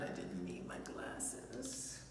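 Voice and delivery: whispered to herself; whispered aside. Real, not acted. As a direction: mutter it under your breath. Squinting aside while reading.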